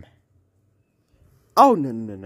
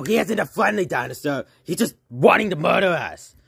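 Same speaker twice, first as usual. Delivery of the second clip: ordinary talk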